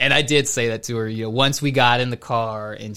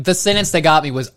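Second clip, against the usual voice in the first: normal talking voice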